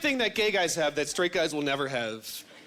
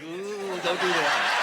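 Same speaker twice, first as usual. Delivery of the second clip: mock warning; much slower than usual. Buddies reacting to the straight man, teasing ooh. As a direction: tease him with a knowing ooh. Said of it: "buddies that are just like".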